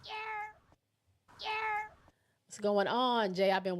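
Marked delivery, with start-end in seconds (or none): 0.0-1.4 very high, soft yerp call; a squeaky little yerp, almost breathed. Pretend. Greeting a viewer with her yerp call, very high and soft. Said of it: "I forgot to say your little yerp". 1.4-1.9 very high, fuller yerp call. Pretend. Greeting a viewer with her yerp call, high and fuller. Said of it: "I forgot to say your little yerp".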